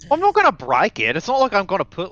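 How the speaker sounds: loud, high-pitched protest; indignant protest. Acted. Pilot defending himself to the drone's owner, loud protest. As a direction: push back hard, pitch jumping up. Character protesting in acted argument.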